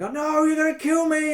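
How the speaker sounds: high, whiny mock terror; mock fear. Hostage pleading with the players, mock terrified whine. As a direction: plead like a scared captive.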